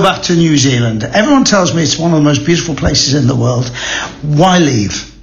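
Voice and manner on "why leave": normal talking voice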